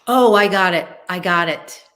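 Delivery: loud burst of realization; excited breakthrough, loud at the start; overexcited. Real, not acted. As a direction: jump on it, excited at working it out. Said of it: "overexcited here".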